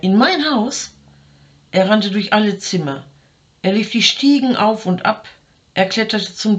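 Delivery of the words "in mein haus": shouting question. A narrator's reading. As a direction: shout it as if it can't be true. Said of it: "schrie er". (ungläubig rufende Frage, hoch)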